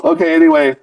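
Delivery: ordinary delivery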